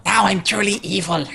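high, creepy evil alien voice; scorpion voice. Retold or imitated. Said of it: "High pitched. It's creepy".

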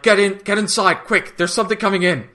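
high, urgent calling out; acted-out alarmed call; imitated urgent warning. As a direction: hurry them inside, raising the pitch. Voices Dargo urgently warning the couple.

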